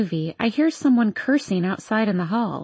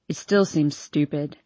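a hint of a drunk young man; town drunk; 5 semitones lower than usual.

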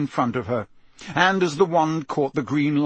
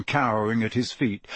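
usual speaking voice

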